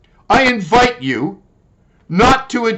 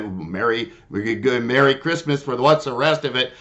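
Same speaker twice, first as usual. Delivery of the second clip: sarcastic Merry Christmas; 5 semitones lower, 6 dB quieter than usual. Pretend. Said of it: "that makes me say".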